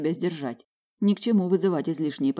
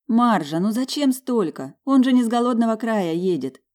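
higher, louder playful reproach; gentle scolding; 6 semitones higher, 4 dB louder than usual. Acted. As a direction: scold the old woman fondly. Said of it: "пожурила я старушку".

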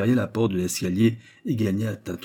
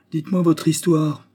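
loud, high, brusque order; impatient demand; 8 semitones higher, 5 dB louder than usual. A narrator's reading. Old man hurrying the girl's story, impatient. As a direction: order her to get on with it.

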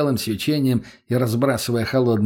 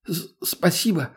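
slow, high, stammered thanks; stammering; 6 semitones higher than usual. A narrator's reading. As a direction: stumble on the first sound, timidly. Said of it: "заикаясь".

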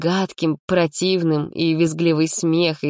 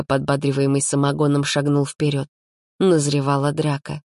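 plain reading voice

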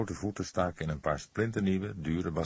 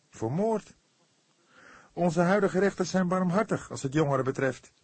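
much higher, fervent and urgent; passionate; 11 semitones higher, 4 dB louder than usual. A narrator's reading. Fledder heatedly convinces the cook, passionate. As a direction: build the case heatedly. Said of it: "betoogde hij hartstochtelijk".

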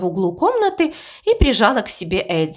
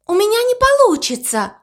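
terrified whisper; 11 semitones higher than usual. A narrator's reading. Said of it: "с ужасом прошептала Мэйзи".

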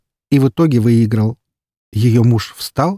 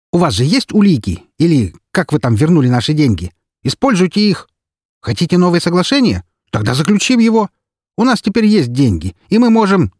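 angry pushing; 9 semitones higher, 4 dB louder than usual. Acted. Yudina pushing the detective.

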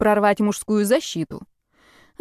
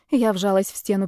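plain reading voice

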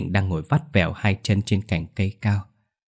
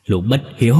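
louder burst of contempt; menacing growl; 3 semitones higher, 5 dB louder than usual. A narrator's reading. Ghost father cursing his unfilial son, growling angrily. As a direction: spit it out as an accusation. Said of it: "gằn giọng".

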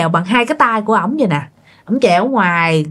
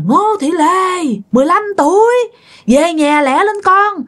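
calling from the roof; 9 semitones higher than usual. Retold or imitated. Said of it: "ông ngoại kêu nha". (gọi to, kéo dài, giọng cao từ trên nóc nhà)

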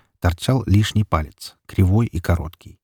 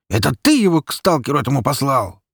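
high-pitched, spiteful outcry; angry cracking shout; 6 semitones higher than usual. A narrator's reading. Petka accusing his father, shrill angry cry. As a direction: cry out angrily, voice breaking upward. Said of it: "давая петуха, зло выкрикнул он".

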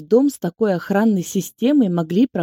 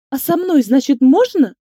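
high, loud outraged retort; 6 semitones higher, 6 dB louder than usual. A narrator's reading. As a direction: hurl it back at him. Inga outraged at Egor.

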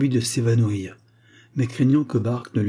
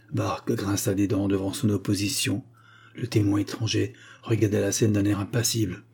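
plain reading voice

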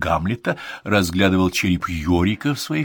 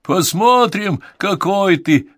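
higher, louder taunting yell; heckler shout; 11 semitones higher, 6 dB louder, slower than usual. Acted. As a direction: jeer at the man onstage. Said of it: "снова крикнули из зала".

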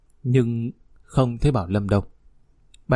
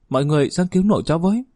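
high, fast, urgent call; crying out for help; 6 semitones higher, much faster than usual. A narrator's reading. Granddaughter calling neighbours to save her grandfather, urgent cry. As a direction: call out in rising alarm. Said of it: "rồi kêu lên".

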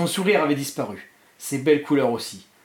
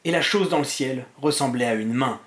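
usual speaking voice

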